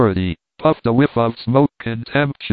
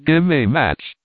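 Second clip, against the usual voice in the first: pert command; 4 semitones higher than usual. A narrator's reading. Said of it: "in a tone of pert command".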